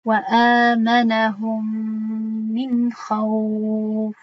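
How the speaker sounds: «хавф» held four counts, longer; four-count lengthening. Shown as a demonstration. Quran teacher demonstrating the four-count madd on «хавф». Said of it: "Чор ҳаракат".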